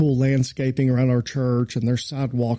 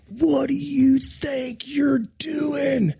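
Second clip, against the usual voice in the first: high hoarse mock scream, half whispered; retold scream; 12 semitones higher, much slower than usual. Pastor retelling the groundsman yelling at him, mock scream. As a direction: imitate the angry groundsman screaming. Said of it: "he is screaming at the top of his lungs".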